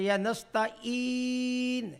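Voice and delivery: slow chanted nastaeen; Quran recitation. Shown as a demonstration. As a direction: draw out نستعین in chant. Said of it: "اونچی پڑھا نا".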